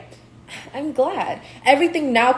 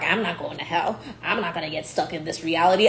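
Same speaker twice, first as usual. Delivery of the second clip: lower, softer mock of a smug denier; mocking stuck souls; 5 semitones lower, 5 dB quieter than usual. Mocking people stuck in limbo who deny it, low scornful voice. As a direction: imitate them, lightly scornful. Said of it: "these people try to act like".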